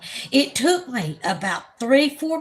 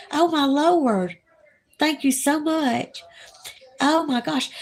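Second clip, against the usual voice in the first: thrilled; 6 semitones higher than usual. Real, not acted. Thrilled at gaining ten subscribers.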